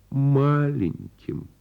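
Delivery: ordinary delivery, nothing marked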